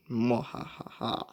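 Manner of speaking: slow, soft villain laugh; a quiet mock evil laugh. Gloating over overpowered items.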